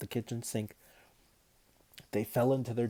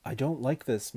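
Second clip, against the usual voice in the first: usual speaking voice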